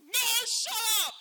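high, loud embarrassed yelp; mock defensive shout. Denying to his girlfriend that he is crying, loud high yelp. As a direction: yell it to cover up tears. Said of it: "are you crying?".

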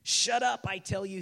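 short-tempered acted outburst; impatient snarl. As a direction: snap it, fed up with the pest. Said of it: "with a snarl of impatience".

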